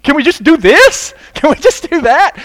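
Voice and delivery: high, loud pleading with the pastor; whining congregant. Retold or imitated. Church member begging pastor for a lighter fast, loud whine. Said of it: "some people that did not like".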